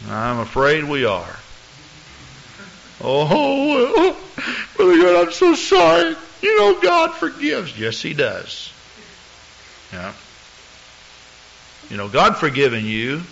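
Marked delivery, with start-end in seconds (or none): 3.0-7.8 high, pleading falsetto; whiny pleading. Retold or imitated. Church member begging the pastor to keep the class, high whine.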